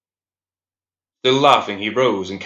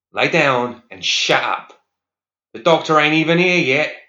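gruff guard; 6 semitones higher, 5 dB louder than usual. Acted. Guard yelling at inmate.

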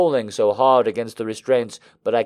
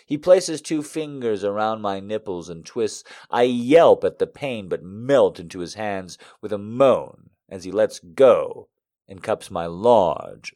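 usual speaking voice

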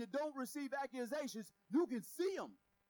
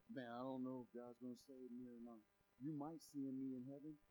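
doubting believer; 10 semitones lower, 9 dB quieter than usual. Acted. Voicing a doubting churchgoer.

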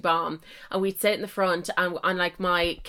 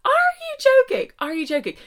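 high, loud disbelieving shriek; hammed-up shocked yell; outraged shriek; 8 semitones higher, 7 dB louder than usual. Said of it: "I'd be like".

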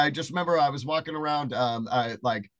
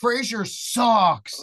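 high, loud, drawn-out mock jeer; gleeful put-down; 9 semitones higher, 6 dB louder, much slower than usual. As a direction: stretch it out as a crowing punchline. Retold crushing punchline to a fan.

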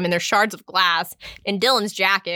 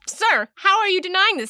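incredulous quote; 10 semitones higher than usual. Retold or imitated.

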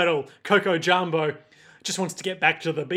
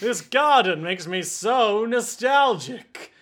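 put-on slow, raised old-man voice; 4 semitones higher, 3 dB louder, slower than usual. Aged Narantia reads a magazine, slow old man. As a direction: draw it out like a doddery old man. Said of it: "like an old man would".

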